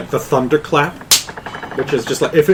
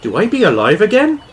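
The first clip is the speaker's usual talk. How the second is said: put-on British accent; 6 semitones higher than usual. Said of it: "Why do I sound so hotly and classy".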